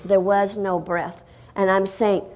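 ordinary talk

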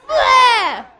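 loud shouted gagging bleh; big hammed-up retching yell; mock retch. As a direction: fake a seasick heave, shouted. Seasick call and retch response.

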